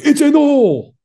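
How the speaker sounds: high, loud celebratory yell; excited shout. Retold or imitated. Friend announcing a hole in one, excited shout. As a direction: holler the big news across the green.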